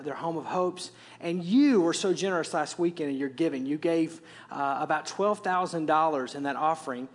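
usual speaking voice

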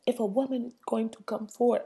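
badly faked foreign first-lady accent; Melania's accent. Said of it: "I wanna do her accent".

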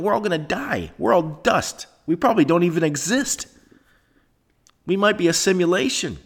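high-pitched mock-serious rant; sarcastic. Real, not acted. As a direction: push it up high with heavy sarcasm. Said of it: "If you don't hear the sarcasm in the voice".

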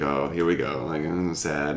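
low, soft retold gloom; understated mock-somber murmur; subdued sadness. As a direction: mumble it low, imitating a downcast visitor. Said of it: "sitting in this really somber museum".

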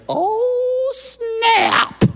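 squeaky very high, loud "oh snap"; a cheeky servant girl gasping at gossip; sassy maidservant. Acted. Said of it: "a maidservant in the back says".

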